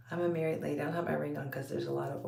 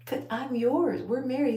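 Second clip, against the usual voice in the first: high, loud retold protest; mock protest; 8 semitones higher, 7 dB louder than usual. Wife retelling her objection to husband's remark, in mock protest. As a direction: push it up high, playfully indignant.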